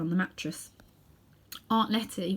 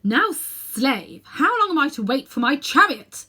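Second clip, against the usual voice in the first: loud commanding roar, pitched higher; thundering; 6 semitones higher, 7 dB louder, slower than usual. A narrator's reading. Witch thundering at cowering Uncle Andrew. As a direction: thunder it at the slave. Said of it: "thundered the witch".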